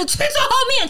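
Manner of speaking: mock yell. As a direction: toss off a high mock bark. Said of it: "吼的台词竟然是". (高声模仿吼叫)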